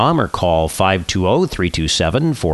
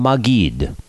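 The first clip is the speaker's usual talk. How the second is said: usual speaking voice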